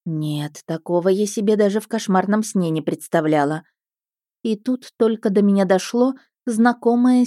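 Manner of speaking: ordinary delivery, nothing marked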